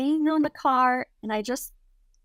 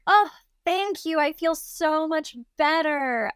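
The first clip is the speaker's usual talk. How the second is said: high, mock-innocent gratitude; dumb and innocent; 7 semitones higher, slower than usual. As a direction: gush thanks as if blissfully clueless. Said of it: "dumb, innocent".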